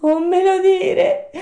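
very high, loud horrified puppet cry; drawn-out scared shout; frightened yell. Acted. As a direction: shriek it slowly in dread. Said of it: "urlò Pinocchio, che tremava ancora dalla paura".

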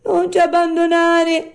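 very high, loud wail; whining plea. Acted. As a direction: implore as the beggar fox. Fox begs not to be left.

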